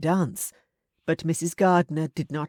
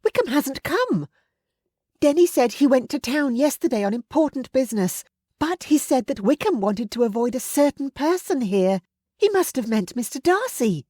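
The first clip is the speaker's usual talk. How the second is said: put-on lighter, higher young woman's voice; young lady; 9 semitones higher, 4 dB louder than usual. A narrator's reading. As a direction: speak as an earnest young lady, raised pitch.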